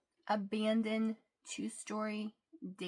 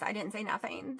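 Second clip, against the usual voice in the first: ordinary talk, nothing marked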